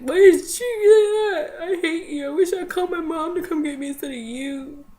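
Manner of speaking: high, loud tearful wail; hammed-up sobbing tantrum, easing slightly toward the end; mock bawling. Daughter mad at dad in the car, bawling complaint. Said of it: "I was bawling my eyes out".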